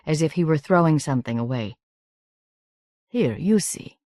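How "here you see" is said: put-on higher-pitched, gently accented English; lightly accented foreign host; soft foreign accent. A narrator's reading. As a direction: offer it in a soft Romanian accent. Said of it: "in his soft accent".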